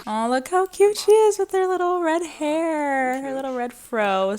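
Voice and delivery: high, delighted coo; sweet high fond gushing. Real, not acted. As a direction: gush over how cute she is. Cooing over the baby sim.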